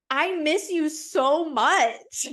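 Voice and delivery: drawn-out, high, loud wail; exaggerated lovesick whine; plaintive longing. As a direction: stretch every word into a hammed-up plea. Acted plea to absent boyfriend.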